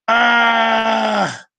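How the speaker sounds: high, long held scream; mock scream. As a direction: scream in mock dismay at the sign. Mock horror at learning Aquarius.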